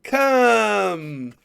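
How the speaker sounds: loud, high showy beckoning; loud high hammy calling; hammy Hugh Jackman. Retold or imitated. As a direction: call fans over like a ham actor. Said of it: "Hugh Jackman's all".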